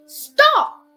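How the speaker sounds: high, loud shouted command; sharp order. A narrator's reading. Lugu orders the driver to stop, loud. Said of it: "Lugu ordered".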